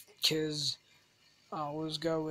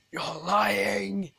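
accusing head teacher; 3 semitones higher, 4 dB louder than usual. Acted. Acted head teacher accusing pupil.

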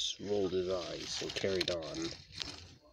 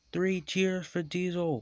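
high-pitched happy cheer; high, celebratory voice; 10 semitones higher, 4 dB louder than usual. A narrator's reading. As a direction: call out a cheer for the hero. Engines cheer the hero.

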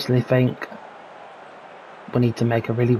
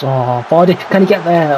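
excited; 4 semitones higher, 5 dB louder than usual. Real, not acted. Chance in front of goal.

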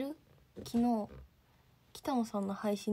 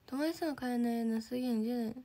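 normal talking voice

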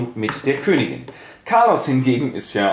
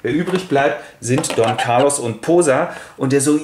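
usual speaking voice

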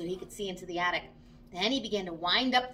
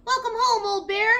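much higher, louder glad greeting; joyful welcome; 11 semitones higher, 7 dB louder than usual. Acted. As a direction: greet him like a long-lost friend.

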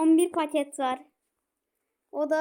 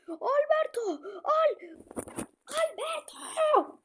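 high, slow, drawn-out yell; frustrated calling; 10 semitones higher, much slower than usual. Real, not acted. Gamer yelling at Alberto to take the ball, drawn-out shout. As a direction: call him out in despair.

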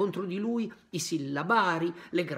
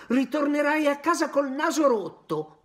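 put-on raised, loud threatening boy; angry threat; 10 semitones higher, 8 dB louder than usual. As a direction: warn him he'll get hurt. Boys threatening.

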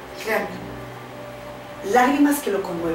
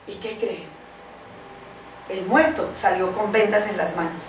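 ordinary delivery

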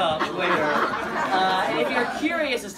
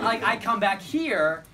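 normal talking voice

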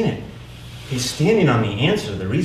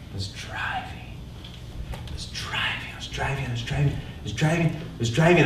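starts whispered, swells to full voice over each repeat; building repetition; 2 semitones higher, 4 dB quieter than usual. Real, not acted. Speaker reliving endless escape driving, whisper building to full voice.

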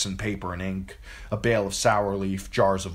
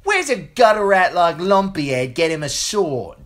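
much higher, loud mocking boy; braying bully; 12 semitones higher, 10 dB louder than usual. A narrator's reading. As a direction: bray the insult at her. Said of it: "he brayed like the donkeys".

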